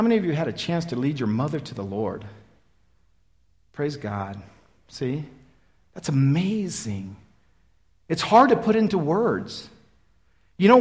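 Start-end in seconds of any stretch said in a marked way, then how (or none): 3.7-7.2 hushed praise. Real, not acted. Emotional aside.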